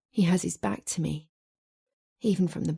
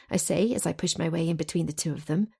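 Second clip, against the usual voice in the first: ordinary delivery, nothing marked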